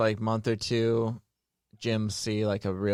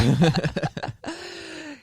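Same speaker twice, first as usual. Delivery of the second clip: high laugh. Real, not acted. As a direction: burst of high-pitched laughter. Reacting to a joke.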